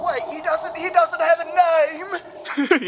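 high, strained distant yell; yelling from afar. Acted. Lookout yelling to the crew from the crow's nest, high-pitched holler. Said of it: "He's yelling from the crow's nest".

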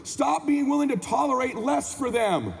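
high, driving motivational sermon; motivational preaching. Acted. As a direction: preach it at full force, leaning on key words. Motivational speech at full force.

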